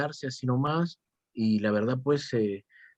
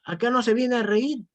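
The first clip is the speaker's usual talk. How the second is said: higher, lecturing mock teacher voice; stern teacher; 8 semitones higher than usual. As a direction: scold like an old-school teacher. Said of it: "me decía y me botaba". (profesor estricto, imitado con tono más agudo)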